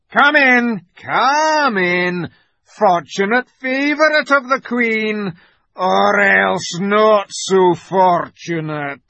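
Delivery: high, loud growling wolf; menacing wolf. A narrator's reading. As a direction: usher him in loudly, then slow into a threat. The wolf speaks again.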